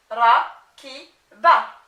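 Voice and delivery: rakiba in slow, loud, separate syllables; loud, segmented "rakiba" for a learner. Shown as a demonstration. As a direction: sound out "rakiba" with gaps between each syllable. Teacher assembles word from spelled syllables.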